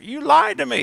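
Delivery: high, hurt mock accusation; accusing whine. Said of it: "I'm like".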